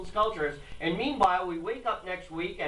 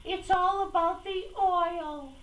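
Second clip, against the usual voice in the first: mock liberal voice; 12 semitones higher than usual. Host mocking liberals in a high sneering voice. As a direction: play devil's advocate in a sneering high voice. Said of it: "I have to play devil's advocate".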